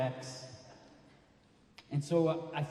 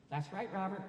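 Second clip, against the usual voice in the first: mild mom voice; 6 semitones higher, 7 dB quieter than usual. Retold or imitated. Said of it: "according to my mom".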